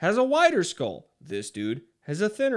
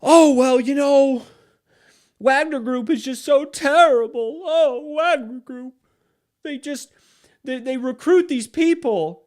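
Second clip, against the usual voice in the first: high, whiny mock voice pitched way up; high, loud mocking impression of a lefty; 9 semitones higher, 5 dB louder than usual. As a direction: sneer it out as a whining lefty caricature. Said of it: "sitting here acting like".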